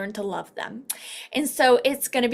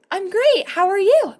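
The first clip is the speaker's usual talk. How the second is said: higher, louder chirpy reply; cheerful referral; 8 semitones higher, 6 dB louder than usual. Pretend. Referral answering the phone call, bubbly. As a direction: answer bright and loud like a happy stranger. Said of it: "they're gonna be like".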